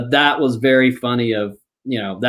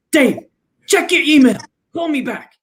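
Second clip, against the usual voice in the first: pitched way up, clipped urgent caller; Mike's terse voice; 10 semitones higher than usual. Retold or imitated. Friend retold calling with urgent orders, high clipped voice. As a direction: voice Mike up high and short on the phone.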